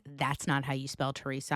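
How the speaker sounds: usual speaking voice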